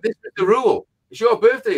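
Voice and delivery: high, indignant reasoning; retold telling-off. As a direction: act out laying down the rule crossly. Retelling his telling-off of her brother.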